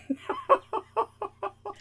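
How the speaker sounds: high, soft laughter; amused laugh. Real, not acted. Teasing a friend about a scream, high laughing. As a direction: let a high laugh trail after the joke.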